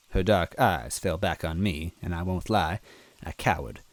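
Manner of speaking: usual speaking voice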